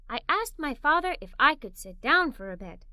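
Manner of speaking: ordinary delivery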